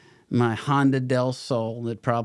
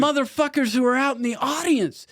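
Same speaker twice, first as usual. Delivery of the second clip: high-pitched, louder mock scolding; exasperated inner rant; 13 semitones higher, 3 dB louder than usual. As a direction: rant at the actor in your head, voice way up. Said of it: "And I'm thinking".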